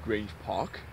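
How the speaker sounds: low quiet questioning mumble; quiet puzzled echo; puzzled murmur. Acted. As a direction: mutter it in disbelief. First take, quiet puzzled.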